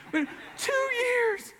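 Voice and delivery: put-on a girl overcome that her friend now knows Jesus. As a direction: let it break into joyful tears. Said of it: "She just loses control".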